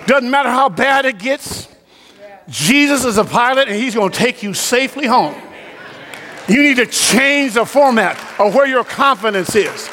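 high, driving preacher's voice; full-force preaching. Real, not acted. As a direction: preach it hard, pitched well above talk.

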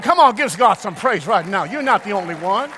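loud, high, ringing sermon delivery; full-force preaching. Acted. Pastor exhorting the church in a loud preaching voice. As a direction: preach it to the congregation at full power.

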